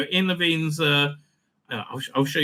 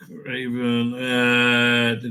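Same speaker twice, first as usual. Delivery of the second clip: ordinary talk, nothing marked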